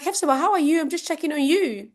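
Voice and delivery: high-pitched friendly check-in; concerned caller. Acted. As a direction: play a friend phoning to check in. Acts a friend phoning to check.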